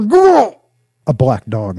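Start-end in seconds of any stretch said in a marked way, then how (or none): 0.0-0.6 very high, loud strangled yell; cut-off yell. A narrator's reading. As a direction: yell out as something seizes you. Jinx is suddenly attacked mid-speech.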